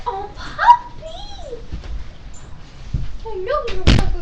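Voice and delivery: very high squeal of delight at the dog; excited at puppy. Real, not acted. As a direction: coo at the puppy. Sees the dog.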